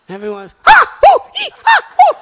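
sudden loud high yelps of pain. Retold or imitated. Devotee stung by jellyfish, loud high yelps. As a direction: shriek as if stung.

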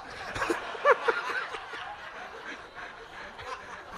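soft airy laugh; breathy laugh. Real, not acted. Teller laughing at his own confusion, airy laugh.

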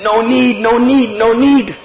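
high, loud, repeated refusal; insistent old man. Retold or imitated.

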